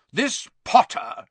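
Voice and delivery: high, loud and slow sneer; loud Aunt Marge. A narrator's reading. Aunt sneering at Harry, high and loud. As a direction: boom his name with contempt. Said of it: "said Aunt Marge loudly".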